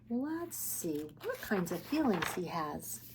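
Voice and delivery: whisper. Real, not acted. Storytime hush before reading.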